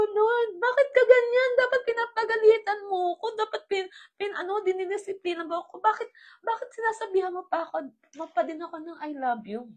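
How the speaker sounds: high, loud, pleading through tears; tearful plea. Retold or imitated. Crying daughter pleading with the Lord, high and tearful. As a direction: beg the Lord to scold her instead. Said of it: "iyak ako na iyak".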